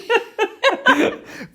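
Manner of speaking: a sudden high-pitched cackle of real amusement; high laugh. Real, not acted. Laughing at his own dig at the suit. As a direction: burst out laughing, loud and high.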